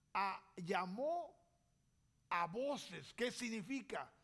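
normal talking voice

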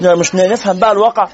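ordinary talk

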